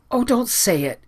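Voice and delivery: high, pleading cry. A narrator's reading. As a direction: beg him not to say it. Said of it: "cried Anne pleadingly".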